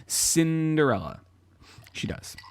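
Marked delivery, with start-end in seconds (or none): 1.9-2.4 soft whispered aside; whispering. Real, not acted. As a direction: breathe it in a whisper.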